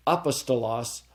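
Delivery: apostolos with stress on initial A; stress on first syllable. Shown as a demonstration. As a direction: say "apostolos" as A-pos-to-los. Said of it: "depending upon what, uh, syllable I accent".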